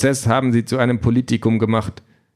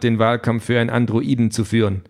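ordinary delivery, nothing marked

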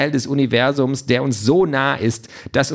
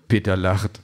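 usual speaking voice

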